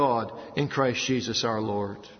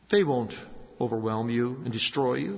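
normal talking voice

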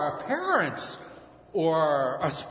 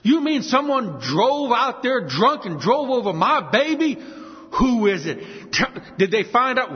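loud, high outraged father; acted anger; 6 semitones higher, 5 dB louder than usual. Father hears of the drunk driver, acted anger. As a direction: rage in disbelief, voice raised. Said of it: "he's angry".

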